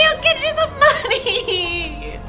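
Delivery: slow sobbing plea; crying plea. Acted. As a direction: drag it out, laughing through tears.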